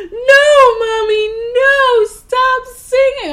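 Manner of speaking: put-on high whiny child voice, drawn out; little daughter pleading. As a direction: beg Mommy to stop like a whiny kid. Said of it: "she looks at me and she goes".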